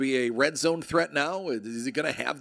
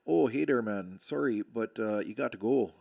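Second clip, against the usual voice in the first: put-on Canadian accent; 3 semitones lower than usual. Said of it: "As only a Canadian could".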